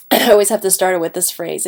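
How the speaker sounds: ordinary talk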